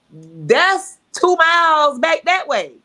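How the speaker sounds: loud, high, drawn-out complaint; exasperated protest. Retold or imitated. Driver arguing with God over a detour, exasperated protest. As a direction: whine it loudly, stretching the words out.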